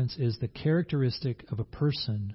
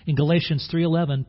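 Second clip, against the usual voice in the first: normal talking voice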